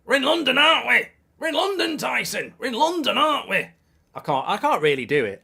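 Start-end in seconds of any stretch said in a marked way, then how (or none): 0.0-3.7 high, loud, strained manager impression; Sean Dyche. Shown as a demonstration. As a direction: shout it as a hammed-up gruff manager. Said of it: "I'm trying to do Dyche".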